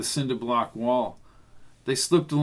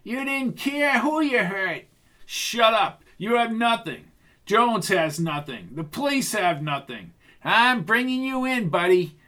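high, agitated confrontation; hot-headed accuser, pitched well up; Bucky's reverberating voice; 8 semitones higher than usual. A narrator's reading. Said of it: "he heard Bucky's voice reverberating".

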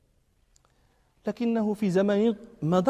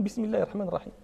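recited basmala; 2 semitones lower, 7 dB quieter, much faster than usual. Shown as a demonstration. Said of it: "بدأ معكم".

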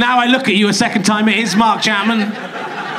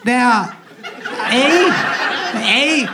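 goofy, pitched-up attempt at a Canadian accent; changed accent, meant as Canadian; 3 semitones higher, much slower than usual. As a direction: put on a silly Canadian voice. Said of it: "I've changed the accent".

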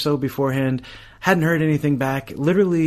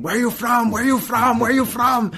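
panicked screaming; 10 semitones higher than usual. Retold or imitated. Panicking secretary shouts at the frozen young visitor, high frantic yell. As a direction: imitate a secretary yelling in a panic. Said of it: "he started screaming".

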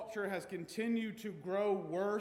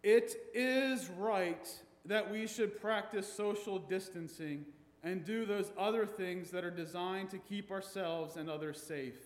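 ordinary delivery

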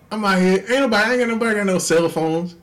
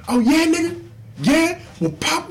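high mock street challenge; mocking tough guy; 12 semitones higher than usual. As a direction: taunt him up high. Acted quarrel outside theater.

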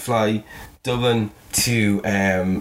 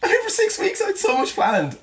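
pitched way up in dismay; high despairing cry. Retold or imitated. Remembering the injury, high-pitched dismay. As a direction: squeal the curse and the ruined plans up high. Initial thought after injury.

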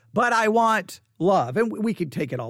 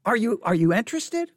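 ordinary talk

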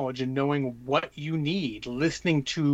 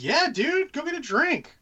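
much higher, easygoing yeah dude; breezy high reassurance; 6 semitones higher than usual. Retold or imitated. Retelling reassuring his tournament opponent, high and breezy. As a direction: wave it off cheerfully. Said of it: "I'm like".

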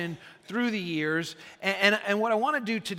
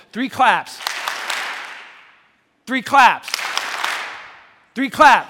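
loud coach shout, each call louder; coach's call; 4 semitones higher, 10 dB louder, slower than usual. Real, not acted. Pastor rallies the congregation like a coach, shouting. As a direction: call it out like a coach rallying the team. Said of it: "get me in that mode".

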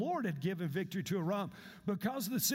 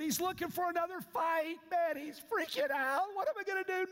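high, frantic voice of a panicked king; panicking king; 9 semitones higher than usual. Retold or imitated. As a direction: squeal it like a king losing it. King tears clothes, freaking out.

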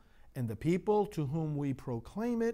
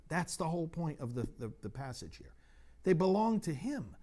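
ordinary delivery

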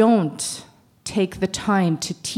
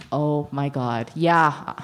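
ordinary talk, nothing marked

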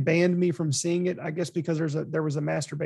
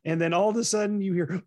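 usual speaking voice

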